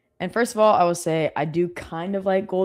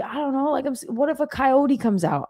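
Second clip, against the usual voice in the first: put-on high anxious little-boy voice; imitating worried son; 9 semitones higher than usual. Son worrying about coyotes, high fretful child voice. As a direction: fret like a small child afraid of the dark. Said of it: "He's like".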